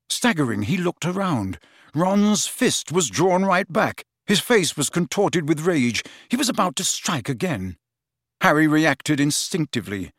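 plain reading voice

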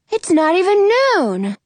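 high, loud shocked "Already?"; surprised boy. Acted. Jonah reacting to losing the wishes, high and shocked. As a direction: jump up in pitch, dismayed. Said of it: "Jonah says".

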